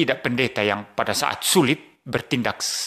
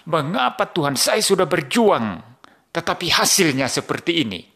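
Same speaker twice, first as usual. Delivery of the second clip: higher, pleading complaint, louder and slowing on the middle; anguished protest to God; 6 semitones higher, 3 dB louder than usual. Retold or imitated. Pastor reliving his plea to God, voice raised in complaint. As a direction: cry out to God like a struggling pastor. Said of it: "saya ada protes terhadap Tuhan".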